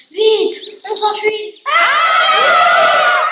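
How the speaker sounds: high frightened shout to run; panicked shout. Acted. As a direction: yell in terror while fleeing. Children flee the witch.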